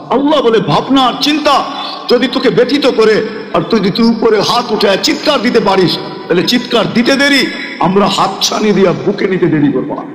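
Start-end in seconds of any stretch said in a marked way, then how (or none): none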